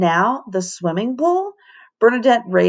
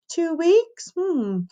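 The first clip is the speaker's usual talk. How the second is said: whine; 10 semitones higher, 3 dB quieter, slower than usual. Acted. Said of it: "Everyone but Emberly whined".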